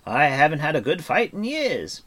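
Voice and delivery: high, loud battle-eager bard; excited. Acted. Bard eager for a good fight, excited. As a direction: relish the coming fight, voice lifted. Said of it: "His face shone with excitement".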